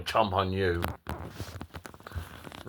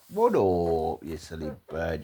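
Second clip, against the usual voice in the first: ordinary talk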